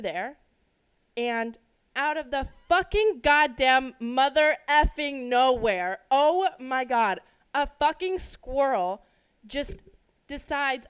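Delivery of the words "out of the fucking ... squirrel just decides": loud, slow, emphatic angry cursing; getting so mad. Real, not acted. Reliving a squirrel darting out, getting so mad. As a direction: punch each swear word hard and drag it out. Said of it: "I'm getting so mad".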